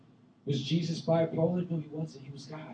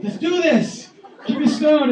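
high, loud gleeful mob cry; hammed-up eager mob shout; 12 semitones higher, 13 dB louder than usual. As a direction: shout it like an eager stone-thrower. Eager stoners in retelling.

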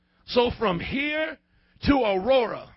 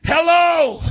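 loud hello to the room; 5 semitones higher, 7 dB louder than usual. Acted. Preacher demanding response from silent room.

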